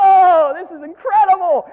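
high, loud gasp of wonder; awed cry. Retold or imitated. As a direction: overcome with emotion. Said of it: "And I'm going".

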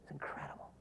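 breathless whispered wonder; awed whisper. Retold or imitated. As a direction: whisper it, stunned. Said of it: "I was like".

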